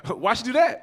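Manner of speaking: mock chuckly question; imitated chuckling. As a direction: mimic a partner amused and asking. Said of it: "she chuckled first".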